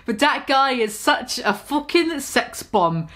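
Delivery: ordinary talk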